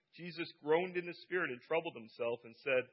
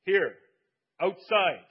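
loud cry; 5 semitones higher, 11 dB louder, slower than usual. A narrator's reading. Jesus calling Lazarus from the tomb, loud cry. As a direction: call out loud and high. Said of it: "he cried with a loud voice".

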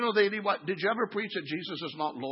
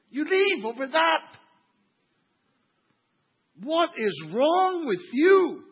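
loud, high, incredulous rebuke; drawn-out exasperated shout, leaning on each word; exasperated scolding; 9 semitones higher, 7 dB louder, slower than usual. Real, not acted. Pastor rebukes people who leave over chairs, exasperated.

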